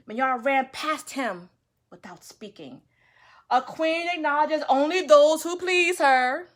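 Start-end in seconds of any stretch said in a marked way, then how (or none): 3.5-6.5 loud proud declaration; haughty. Acted. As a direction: announce it with arrogance. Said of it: "she said to herself".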